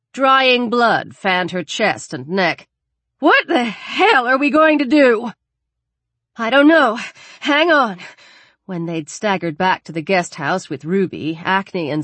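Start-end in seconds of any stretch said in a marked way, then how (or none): 3.2-5.3 high, loud, distraught demand; frantic raised voice; panicked question. Acted. Panicked after stopping the bleeding. 6.4-8.0 higher, louder, stressed answer; tense, urgent stalling; harried reply. Acted. Harried reply while searching packs.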